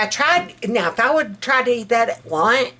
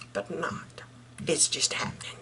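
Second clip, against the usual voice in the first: whispered; 6 semitones lower, 14 dB quieter than usual. Real, not acted. Confessing weight gain.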